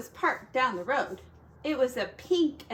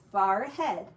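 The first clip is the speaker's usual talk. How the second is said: plain reading voice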